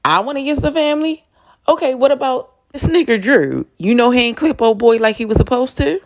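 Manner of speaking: high, fast indignant comeback; mock outraged Kane. Kane firing back at Lorenzo, high and fast. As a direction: throw the accusation back, incredulous. Kane voiced defending himself.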